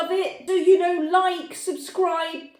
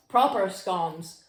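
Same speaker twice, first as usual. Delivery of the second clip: mock Devonshire accent; 6 semitones lower, slower than usual. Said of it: "as good as my Devonshire accent".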